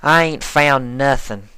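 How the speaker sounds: a tough farm pony voice; plain-spoken Applejack voice; country farm mare. Acted.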